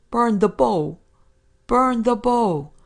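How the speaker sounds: high, loud drawn-out call; shouted order. A narrator's reading. Narrator shouting to the boatswain to burn the crossbow, urgent shout. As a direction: holler it slowly as a command across distance. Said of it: "Twice I shouted to him".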